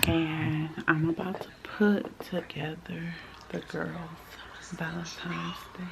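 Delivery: hushed, very soft late-night whisper; real whisper. Real, not acted. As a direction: keep it down so nobody nearby hears. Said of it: "I'm whispering because".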